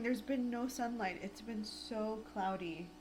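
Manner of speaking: usual speaking voice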